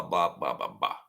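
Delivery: usual speaking voice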